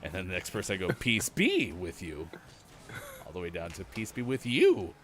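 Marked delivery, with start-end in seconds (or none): 0.9-2.3 slow, be held and stressed. Shown as a demonstration. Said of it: "emphasis on each syllable". 3.8-4.9 slow, you stressed with a falling end. Shown as a demonstration. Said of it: "emphasis on each syllable".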